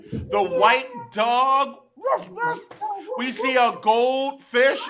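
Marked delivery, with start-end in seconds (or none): 2.0-2.7 a small puppy yapping; dog bark. Acted. Teacher to kids, a high little puppy woof. Animal sounds in picture book.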